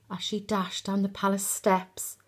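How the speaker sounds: usual speaking voice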